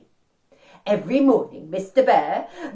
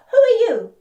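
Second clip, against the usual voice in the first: much higher, louder character voice; storybook bear's question; 13 semitones higher, 8 dB louder than usual. Acted. Mister Bear asks Dog his name, high and loud. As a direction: pitch the question right up.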